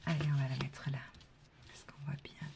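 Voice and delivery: hushed whisper to herself. Real, not acted. Card reader whispering while laying the card. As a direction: whisper as if not to disturb.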